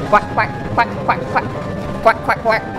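quacking like a duck; playful duck quacks. Retold or imitated. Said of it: "Can you make the sound of a duck?".

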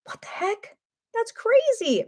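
high-pitched disbelief; amazed. Real, not acted. Preacher marvels at demons entering animals, high amazed voice. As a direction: squeak out your disbelief, way up. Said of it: "this is crazy".

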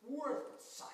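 "Worth sight" said with puckered lips; prune-mouthed Old English. Shown as a demonstration. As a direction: pucker up and pronounce "Worth sight" as an archaic word. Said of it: "look like you've eaten about three prunes".

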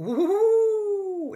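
high, loud wonder-struck ooh; big high dinosaur ooh; amazed ooh. Acted. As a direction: gasp it out in awe. Dinosaurs gawping at ankylosaurus.

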